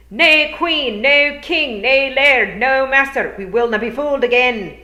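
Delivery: high, loud chanted cry; crowd shout. A narrator's reading. Feegles outside drowning out the bard, a loud high rallying cry. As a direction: roar it out as a rebel crowd. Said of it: "drowned out by a cry".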